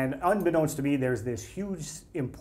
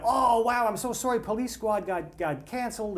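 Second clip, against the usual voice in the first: gushing fan; 10 semitones higher than usual. Retold or imitated. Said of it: "And I'm like".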